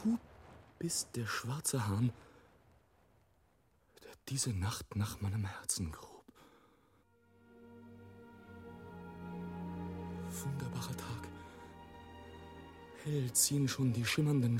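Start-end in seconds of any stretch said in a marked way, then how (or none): 0.8-6.1 low, soft, near-whisper; dark hushed dread. Acted. Dark recognition after the outcry. 10.3-11.3 very soft murmur of awe; hushed wonder. Acted.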